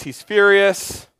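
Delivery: ordinary talk, nothing marked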